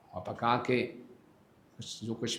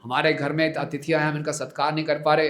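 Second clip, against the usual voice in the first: put-on louder, higher scolding of the boy; angry scolding; 5 semitones higher, 8 dB louder than usual. Father scolds unruly Nimai before the guest, angry scolding. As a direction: scold him with fury. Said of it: "Jagannath Mishra को बड़ा क्रोध आया".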